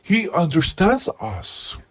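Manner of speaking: a mild take on a sincere relative; family member's voice. Retold or imitated. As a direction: speak as the earnest family defending the pet. Family voiced in a retold argument.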